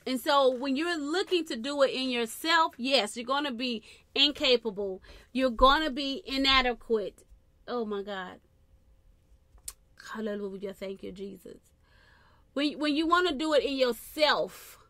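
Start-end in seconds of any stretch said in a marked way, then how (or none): 0.1-7.2 loud, ringing preacher's cry; preaching at full force. Real, not acted. 12.5-14.8 louder, rising preacher's call; loud preaching. Real, not acted. Preacher peaks again.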